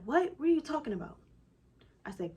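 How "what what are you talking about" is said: high, disbelieving mock question; incredulous husband. Wife imitates husband hearing baby is coming, incredulous. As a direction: imitate a startled husband, pitched up.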